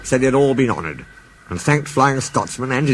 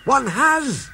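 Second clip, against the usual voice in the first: a much higher Scots voice; Scottish engine. Acted. Donald tells the Fat Controller an engine escaped, in a Scottish voice. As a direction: Donald delivering his news.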